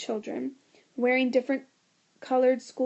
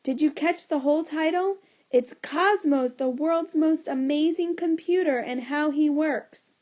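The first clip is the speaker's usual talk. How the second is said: louder yell; 5 semitones higher, 5 dB louder than usual. A narrator's reading. Boy yelling his talk title so everyone hears. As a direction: belt the title out even louder. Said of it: "yelled even louder".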